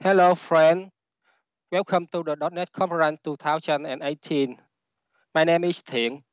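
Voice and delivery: Vietnamese-accented English; non-native English with a Vietnamese accent. Real, not acted. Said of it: "English is not my first language".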